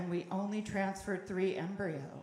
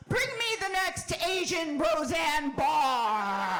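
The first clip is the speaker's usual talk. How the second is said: mock casting director; 4 semitones higher, slower than usual. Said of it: "casting directors out there going".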